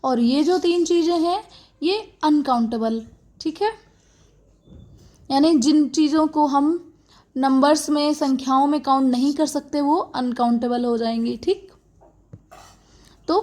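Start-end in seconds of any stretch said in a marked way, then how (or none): none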